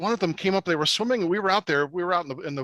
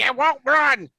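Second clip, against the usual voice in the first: high, loud mock complaint; retold childish outburst, pitched way up; imitated frustrated kid; 9 semitones higher, 6 dB louder, slower than usual. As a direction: mimic a little girl yelling on a stubborn cow. Acted quote of girl on cow.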